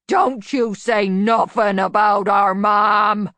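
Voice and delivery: slow, loud, booming shout; voice like an earthquake. A narrator's reading. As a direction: rumble out a furious warning. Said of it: "The voice was like an earthquake".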